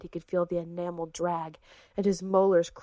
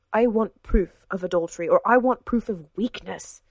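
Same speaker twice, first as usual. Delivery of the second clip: louder, biting angry demand; hissed demand; 8 dB louder than usual. A narrator's reading. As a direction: spit it out in fury. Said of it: "hissed Richard".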